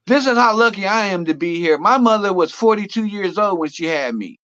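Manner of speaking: ordinary talk, nothing marked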